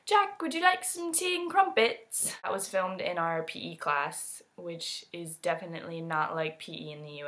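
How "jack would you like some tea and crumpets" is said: high, slow fake British voice; mocking British accent. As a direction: poke fun with a posh-tea British accent. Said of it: "We always make fun of him".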